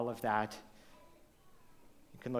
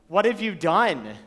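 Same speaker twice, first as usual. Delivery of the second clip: higher, louder accusing question from a parent; 5 semitones higher, 11 dB louder than usual. Retold or imitated. Parent confronting kids who did something wrong, accusing tone. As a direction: catch the child out and demand an answer. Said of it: "And usually in that tone".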